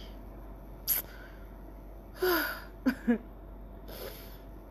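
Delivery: heavy drawn-out exhaled sigh; long unvoiced sigh. Real, not acted. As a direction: let out a long weary breath.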